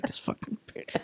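unvoiced under-the-breath curse; a seething whisper of an inner thought at the game. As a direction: whisper it so she can't hear. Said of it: "I'm over here like".

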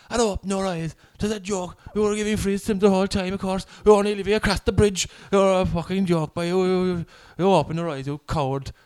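imitating parents having a go at the referee; retold heckling. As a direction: jeer like an angry parent, half-strength. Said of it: "'Cause they were shouting it".

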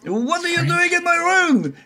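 high, shrill French chef panic; freaking out. Acted. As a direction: shriek at intruders in his room. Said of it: "And he's just freaking out".

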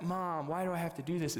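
lightly voiced whining kid; complaining child. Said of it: "But as children".

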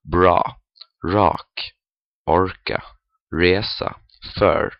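bra, rak, orka, resa, för with a heavy American R; untrilled American R in bra, rak, orka, resa, för; thick American R. Shown as a demonstration. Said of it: "a thick American R".

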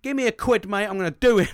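rowdy acted-out call to arms; typical angry bloke. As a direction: act out a mate shouting for revenge. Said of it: "it's kinda like that".

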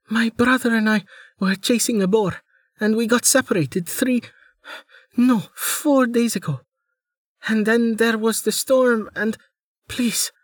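high, pleading girl playing injured; a young woman faking helplessness, high and breathy with need; pitiful young woman. Amelia's tale to the armed man.

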